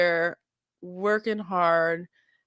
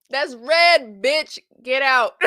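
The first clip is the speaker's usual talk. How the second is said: imitated dismissal; 5 semitones higher, 6 dB louder, slower than usual. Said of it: "And she gonna be like".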